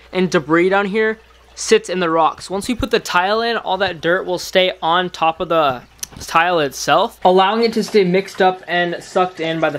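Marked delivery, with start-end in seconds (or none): none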